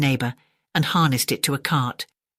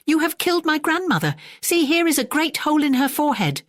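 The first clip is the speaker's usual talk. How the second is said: outraged accusation; 12 semitones higher than usual. A narrator's reading. Accusing the landlord by the throat.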